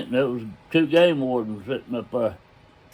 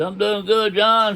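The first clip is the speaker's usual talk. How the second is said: high, raised-voice answer across the distance; called-back reply; 8 semitones higher, 3 dB louder than usual. Retold or imitated. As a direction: imitate someone hollering a friendly reply. Warden hollers back from the bank.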